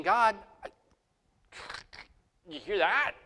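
a hint of a stunned aside to God; lightly voiced, half-amused complaint to God; imitated exasperated prayer. Preacher acts his own prayer.

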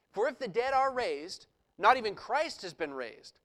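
loud, emphatic preaching of the passage; scripture reading. Acted. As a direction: proclaim it to the congregation. Reads Paul's letter aloud.

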